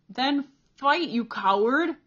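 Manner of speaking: loud, frenzied taunting yell; mad scream. A narrator's reading. Bully kicking Cole screams at him, furious. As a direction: scream it like a madman. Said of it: "Peter screamed like a madman".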